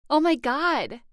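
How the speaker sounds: high, loud cry of alarm. A narrator's reading. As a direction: shout it out in shock. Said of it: "I cried".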